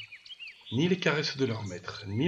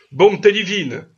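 high, very loud exclamation; exasperated outcry; 9 semitones higher, 14 dB louder than usual. A narrator's reading. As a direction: burst out in exasperation. Master exclaims at goat's stubbornness. (exclamation exaspérée)

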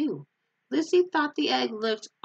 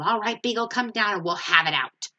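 a touch of a mock yell, louder; challenging yell; 3 semitones higher, 5 dB louder than usual. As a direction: call him out for a fight. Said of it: "and yelled".

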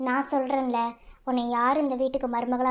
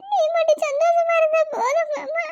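tearful pleading; 4 dB louder than usual. Acted. Dying woman comforts crying husband.

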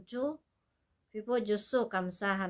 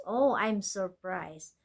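slow model phrase; much slower than usual. Shown as a demonstration. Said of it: "어머".

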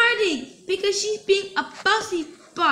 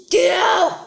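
angry shout; 3 semitones higher, 6 dB louder than usual. Acted. Parent firing babysitter.

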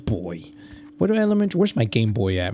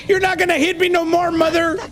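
put-on defiant kid; 11 semitones higher than usual. Kid defying game mother.

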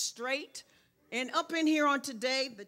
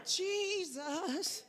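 high whoop, then a drawn-out Jesus; mock church mother, pitched high; 5 semitones higher, 4 dB quieter, much slower than usual. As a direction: imitate a church mother's pious moan. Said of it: "All I gotta say is".

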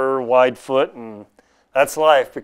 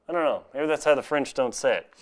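ordinary talk, nothing marked

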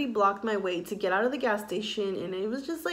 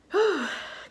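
long shaky sigh; heavy sigh; 10 semitones higher, 3 dB louder than usual. Real, not acted. Vlogger sighing over a hard month, heavy sigh. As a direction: let out a heavy emotional sigh. Emotional sigh before talking.

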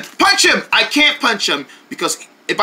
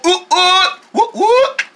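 celebrating whoops; 10 semitones higher, much slower than usual. Acted.

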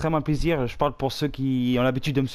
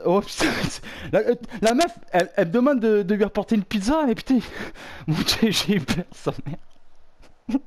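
nervous laughing; 6 semitones higher than usual. Real, not acted. Laughing off the fright.

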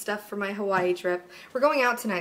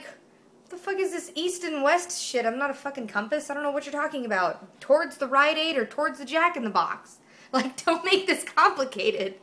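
higher pitched, exasperated complaint; mock exasperated rant; 5 semitones higher than usual. Yelling at dad over his directions, mock exasperated. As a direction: rant like a frustrated kid. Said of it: "I'm like".

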